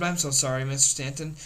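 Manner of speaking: usual speaking voice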